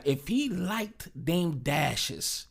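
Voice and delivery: normal talking voice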